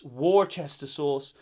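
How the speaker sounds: slow Worcester as "war-chester"; WAR-chess-ter for Worcester; deliberate mispronunciation. Shown as a demonstration. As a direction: say Worcester slowly as written, with "chester". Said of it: "it's pronounced Wors".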